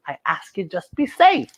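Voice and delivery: ordinary delivery, nothing marked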